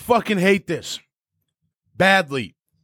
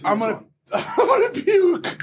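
laughing; 13 semitones higher than usual. Real, not acted. Laughing at hot sauce.

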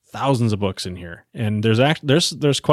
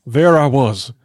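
slow, louder audiobook narration; dramatic storyteller; 4 dB louder, slower than usual. Acted. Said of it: "you gotta do all the voices".

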